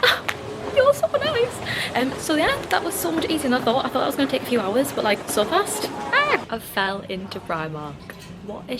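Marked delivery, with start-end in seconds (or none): 0.0-1.6 gushing thanks. Retold or imitated. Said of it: "And I was like". 5.9-6.5 excited squeal. Real, not acted. Excited exclamation entering shop.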